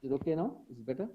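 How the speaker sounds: faint, distant mic; speaking far from the mic; barely audible. Real, not acted. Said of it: "hardly, barely audible".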